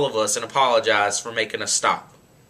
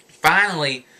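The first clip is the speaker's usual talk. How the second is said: plain reading voice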